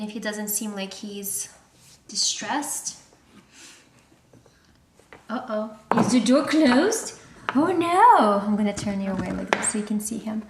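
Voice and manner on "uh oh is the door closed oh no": playful fake worry, rising high; mock alarm. Talking to the puppy in the crate, hammed-up uh-oh. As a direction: pretend to be shocked for the puppy.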